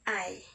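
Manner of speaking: I said as a lone "ai" glide; teacher modelling I, set apart; diphthong demo. Shown as a demonstration. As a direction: sound out I like "eye".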